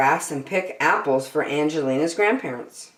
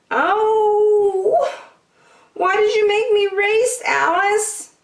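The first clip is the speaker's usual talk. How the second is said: high, loud hurt outburst; angry cry; 6 dB louder, slower than usual. Acted. Hurt Angelina blaming Alice after the crash, loud angry cry. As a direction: wail it at your friend, blaming her. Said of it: "cried Angelina, angrily".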